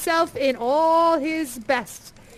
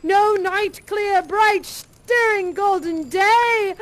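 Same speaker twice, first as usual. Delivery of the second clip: joyful wonder; 6 semitones higher, 6 dB louder than usual. A narrator's reading. Joyful morning narration.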